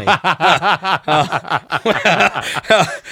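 long high laugh; laughing at the tomato joke. Real, not acted. Laughs at accent teasing.

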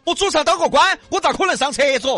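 high, loud stubborn refusal; haughty refusal. Acted. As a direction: refuse with pride each time. Bao refuses loudly.

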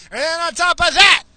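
high, loud mock yell; sudden hammed-up holler; exasperated outburst. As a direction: burst out as if piling on complaints. Ranting, sudden burst of complaint.

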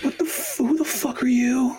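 jumps very high in disbelief; mock outrage. Answering the pompous old guy, very high and outraged. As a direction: squeak up in shocked outrage. His indignant reaction.

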